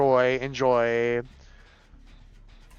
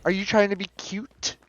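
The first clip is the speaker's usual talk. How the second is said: ordinary delivery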